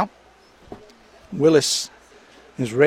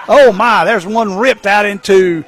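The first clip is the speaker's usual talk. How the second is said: excited shouting play-by-play call; home run call; 7 semitones higher, 7 dB louder than usual. Real, not acted.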